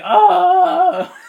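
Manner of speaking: long, high, loud scream; hammed-up sustained cry of pain; mock agony scream. Brent clawed and shot in the back, mock agony scream. Imitating man stabbed and shot.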